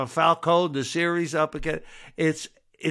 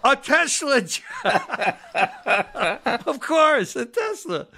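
high, laughing through the words; breaking into high laughter at the mob car joke; 10 semitones higher than usual. Real, not acted. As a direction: laugh it out in amused disbelief. Laughing at the Tesla suggestion.

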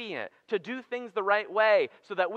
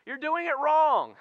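mock disbelief; 9 semitones higher, 5 dB louder than usual. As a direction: pretend to be shocked at holiday haters. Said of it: "I'm like".

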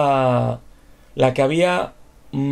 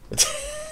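short laugh; 8 dB quieter than usual. Real, not acted. Game glitch amuses him.

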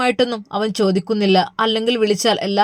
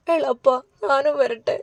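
slow, tearful begging; pleading to go along, starting to sob; sobbing plea; 3 semitones higher, slower than usual. Acted. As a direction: drag it out between sobs. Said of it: "അവൾ തേങ്ങി കരയുവാൻ തുടങ്ങി".

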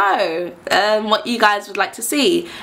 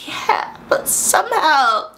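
drawn-out, high-pitched mock reluctance; hesitant; 7 semitones higher, much slower than usual. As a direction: stretch it out, politely unconvinced. Acted reluctant reply to his boasting.

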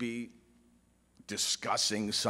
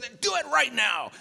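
high, loud insistence; high loud command; 11 semitones higher, 4 dB louder than usual. Retold or imitated. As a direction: order it done on the spot. Ken ordering the proposal.

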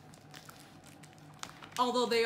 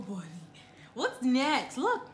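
ordinary delivery

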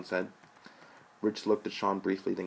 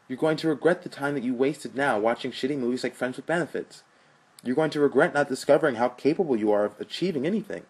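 impassioned plea; 5 semitones higher, 5 dB louder than usual. Acted.

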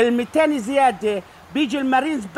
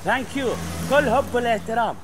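ordinary talk, nothing marked

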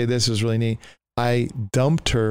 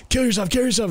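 mock scream; 11 semitones higher, faster than usual. Tormenting voices goading him, mock scream. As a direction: imitate screeching voices chanting at you. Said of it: "just, like, just screaming".

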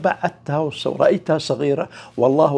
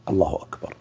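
prayer recitation; 9 semitones lower, 4 dB quieter, faster than usual. Shown as a demonstration. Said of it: "أول كلمة".

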